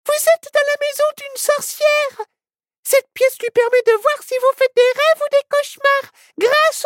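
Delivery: very high, squeaky little animal voice; tiny high-pitched cartoon critter; panicky squirrel.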